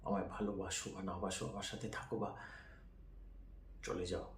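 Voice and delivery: ordinary delivery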